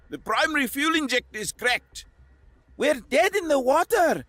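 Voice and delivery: high panicked shout. A narrator's reading. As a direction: shout over the alarm. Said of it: "Koslov shouted".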